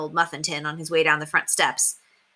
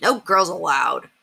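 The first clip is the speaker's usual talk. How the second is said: put-on scowling boy; slower than usual. A narrator's reading. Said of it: "Murph scowled".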